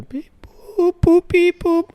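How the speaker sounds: high robotic beeps; beep-boop like a toy robot. Pretend. Imitating a phone transfer beeping.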